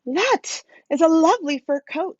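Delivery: a hint of a chatty lady; lady's voice. A narrator's reading. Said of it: "said a lady".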